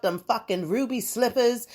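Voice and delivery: ordinary talk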